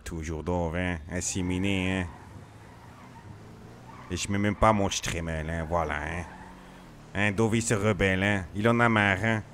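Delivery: lightly voiced gangster character, every line capped with hein; a hint of a grumbling hoodlum, ranting about snitches; slight in-character tough-guy voice; RP character Dove. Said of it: "je commence à être dans mon perso".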